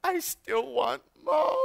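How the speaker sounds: put-on high, slow, tearful little-kid whine; hammed-up child's sob, stretched out; imitated whimpering child. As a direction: mimic your small daughter crying for her mother. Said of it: "she had the audacity to tell me".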